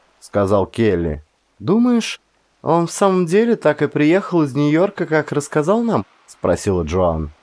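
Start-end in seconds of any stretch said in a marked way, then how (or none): 1.6-6.0 put-on high, doubtful girl's question; a hint of a young woman wondering aloud; lightly voiced girlfriend asking; lightly voiced Joan. A narrator's reading.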